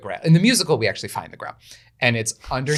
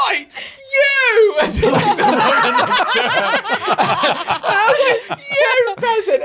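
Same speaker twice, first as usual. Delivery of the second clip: much higher, louder, laughing through the cries; laughing page shout; 9 dB louder, slower than usual. Retold or imitated. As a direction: shout the stage line while cracking up.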